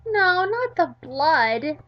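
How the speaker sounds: high, loud and slow, spelling it out; slow insistent Midori. Acted. Midori correcting Yandere Dev slowly, high and loud. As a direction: drag each word out to make him see.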